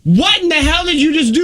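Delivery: high, sharp shock at a sudden noise; jolted by the crunch, demand to know; startled. Real, not acted. Reacting to a loud bite.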